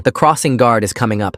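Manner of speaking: ordinary delivery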